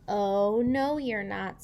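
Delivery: loud, slow no; bossy refusal. Acted. George blocking the doctor at the door, loud and slow. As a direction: draw out the refusal firmly.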